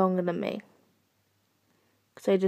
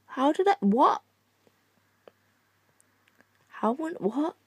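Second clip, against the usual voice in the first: baffled; 6 semitones higher than usual. Real, not acted. Confused by game glitch.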